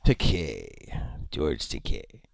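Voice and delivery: George Takei, low and drawn out. Shown as a demonstration. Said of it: "or Takei".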